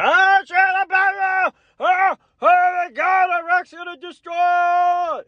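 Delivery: high, loud mock panic shouting; panicked press. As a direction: shriek like a panicking reporter.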